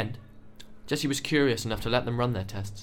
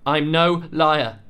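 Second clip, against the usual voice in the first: much louder, higher and very slow; indignant; 6 semitones higher, 10 dB louder, much slower than usual. A narrator's reading. Young man accused of lying, loud indignant denial. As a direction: spit each word out in outrage. Jessie insulted, protesting.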